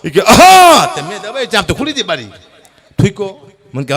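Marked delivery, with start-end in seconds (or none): 0.2-0.9 a big, high, loud hawk of the throat; loud throat-clearing. Retold or imitated. Uncle clears his throat at the car window, loud hawking. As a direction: clear the throat hard like a whistle.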